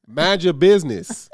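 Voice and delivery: ordinary talk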